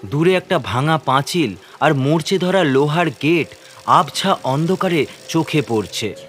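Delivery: ordinary delivery, nothing marked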